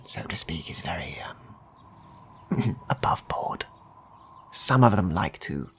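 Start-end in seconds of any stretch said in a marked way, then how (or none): 0.1-3.7 confiding hush. Acted. Merchant confides about thieves.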